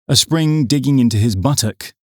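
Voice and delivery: usual speaking voice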